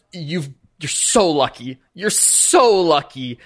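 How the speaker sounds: "so" stretched out long and leaned on; slow, emphatic "so", building; stress on SO. Real, not acted. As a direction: drag out the word "so", harder the second time. Game master exasperated at player's luck.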